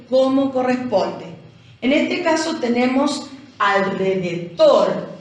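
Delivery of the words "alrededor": "alrededor" with weight on the final dor; teacher stressing the end of "alrededor"; stress on last syllable. Shown as a demonstration. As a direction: lean hard on the dor of "alrededor". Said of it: "cargamos la voz en dor".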